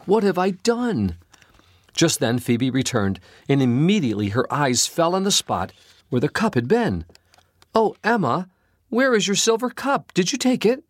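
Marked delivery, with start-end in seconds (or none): 0.1-1.2 slow, high, stricken question; little girl horrified at losing the cup, slow and high; despairing. A narrator's reading. Girl regrets dropping cup. 7.8-10.9 very high, urgent panicked questions; rising panic as she sees it's gone; alarmed. A narrator's reading. Servant alarmed at missing cup.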